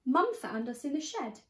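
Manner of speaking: plain reading voice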